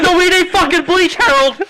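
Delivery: very high, loud screaming; hysterical yell. Retold or imitated. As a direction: scream at him in a panic. Screaming at dad.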